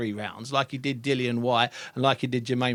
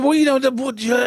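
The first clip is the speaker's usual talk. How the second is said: mildly testy stammer, higher and louder; testy, tetchy; 9 semitones higher, 4 dB louder than usual. Retold or imitated. Imitating young boxer asked about the hype, flustered and tetchy. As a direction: imitate someone getting tetchy at a question. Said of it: "He gets a little bit testy, tetchy".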